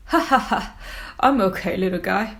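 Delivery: booming jolly whale, laughing then talking; big whale voice. Acted. Big Blue reassuring little Squiddy, jolly and loud. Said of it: "replies Big Blue".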